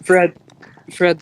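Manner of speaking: sharp loud call to get attention. Real, not acted. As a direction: call his name over the noise. Calling to interrupt Fred.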